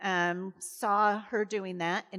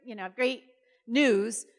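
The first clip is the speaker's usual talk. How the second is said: louder, higher, bursting with good news; excited; 4 semitones higher, 7 dB louder than usual. Retold or imitated. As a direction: blurt out the great news. Delivering good news to mom.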